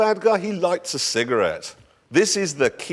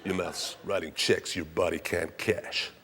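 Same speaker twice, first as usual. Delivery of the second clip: movie tough guy; 9 semitones lower, 11 dB quieter, slower than usual. Pretend. Mock movie line.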